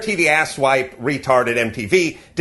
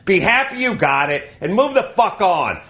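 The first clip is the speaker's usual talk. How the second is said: ordinary talk, nothing marked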